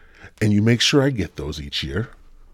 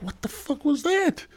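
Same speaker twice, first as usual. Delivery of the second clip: much higher, slower put-on voice of a shocked stranger; startled stranger; 4 dB quieter than usual. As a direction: play someone spooked by a thing hitting their window. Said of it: "they're like".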